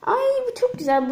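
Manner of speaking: excited squeal. Real, not acted. Delighted at the towel.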